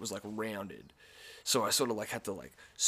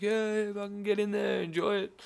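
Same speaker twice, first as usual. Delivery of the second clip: high, loud, cheering fan voice; hyped crowd; 11 semitones higher, 5 dB louder than usual. Retold or imitated. Crowd members urging him to the front, high cheering. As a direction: egg him on like a rowdy punter.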